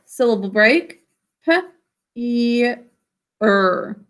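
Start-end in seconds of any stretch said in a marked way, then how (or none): 1.4-4.0 "P, E, er" as puh, long ee, er; sounded out. Shown as a demonstration. Teacher checks spelling with class, "P, E, er" phoneme by phoneme. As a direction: separate sounds with gaps in "P, E, er". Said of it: "Sound it out".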